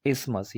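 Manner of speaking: isthmus said ismus, th silent; correct isthmus. Shown as a demonstration. Teacher models isthmus with a silent th. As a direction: drop the th: isthmus as is-mus. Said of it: "वो silent ही पढ़ा जाएगा".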